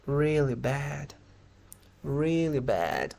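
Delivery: slow, dragged-out glum answer; demonstrated dejection, very slow; sad, depressed. Shown as a demonstration. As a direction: stretch it out as if depressed. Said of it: "Suenen así, suenen tristes".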